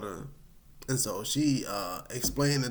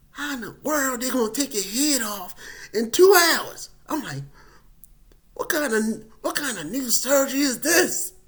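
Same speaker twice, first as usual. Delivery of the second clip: high, loud puzzled question; baffled at the surgery; incredulous; 10 semitones higher, 7 dB louder than usual. Retold or imitated. Wondering about a two-hour surgery, incredulous.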